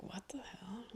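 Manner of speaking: teacher's whispered disbelief. Retold or imitated. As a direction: hiss it under your breath, shocked. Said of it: "Teacher's like".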